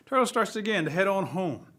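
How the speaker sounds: ordinary delivery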